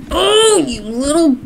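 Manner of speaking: mock outrage. Mock scolding at Marnie.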